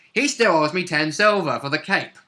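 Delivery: ordinary talk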